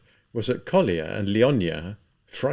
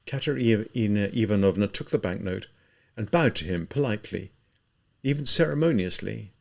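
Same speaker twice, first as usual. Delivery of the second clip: plain reading voice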